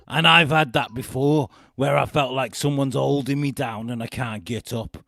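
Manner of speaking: loud, hammed-up Liverpudlian twang; Cheshire accent. Quoting reality star about her ghost, loud Cheshire accent. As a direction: declaim it in a put-on Cheshire accent. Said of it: "I was gonna say a bit Liverpudlian".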